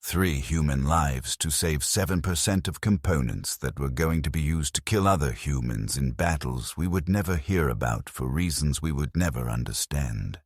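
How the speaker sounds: ordinary delivery, nothing marked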